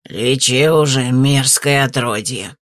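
put-on angry old man. A narrator's reading. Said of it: "взорвался он".

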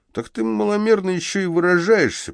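mock the dwarf's words; mocking thug. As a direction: thug mocks the dwarf in a high put-on voice. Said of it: "проговорил Вася".

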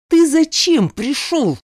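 loud, high, slow, angry demand; angry growl. A narrator's reading. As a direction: snarl it slowly at the intruder. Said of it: "зарычала". (грозный рык)

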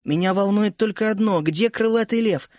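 ordinary delivery

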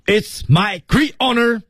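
"It's my great honor" pitched high and slow as one block; 停顿示范. Shown as a demonstration. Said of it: "停顿". (高而慢地读“It's my great honor”，然后停顿)

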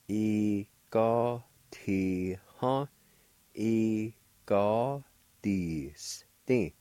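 E ga ti ha said very slowly, each syllable drawn out; slow demo. Shown as a demonstration. Teacher slowing the word down for beginners. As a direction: stretch E ga ti ha for learners. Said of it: "pronounced like this".

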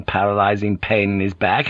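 plain reading voice

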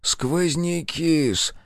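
put-on slow, high reproachful old man's mumble; reproachful old clerk. A narrator's reading. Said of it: "повторил он с укоризной".

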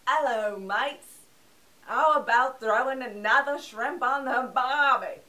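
loud, hammed-up mock Australian; exaggerated fake Australian twang; bad Australian accent. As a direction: parody a terrible Aussie accent at full volume. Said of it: "It sounded something like this".

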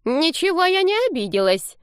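put-on high old-lady denial; indignant grandma, voice pitched high; huffy granny. A narrator's reading. Grandmother overhears and denies offence, high huffy voice. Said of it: "расслышала их родственница".

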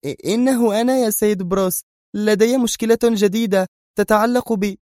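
high, put-on trembling woman's voice. Mona phoning Bruce with a new problem, feigned trembling. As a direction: stammer in and plead, voice pitched right up. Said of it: "تقول بنفس الصوت المرتجف".

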